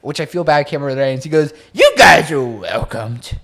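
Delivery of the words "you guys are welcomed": slow, louder slurred invite; drunk Josh voice. Retold or imitated. Friend imitates drunk Josh welcoming the girls, slow and loud. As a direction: drag the words out like a tipsy friend. Retelling drunk Josh's words.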